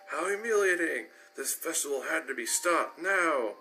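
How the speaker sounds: appalled royal. A narrator's reading. Luna appalled at props.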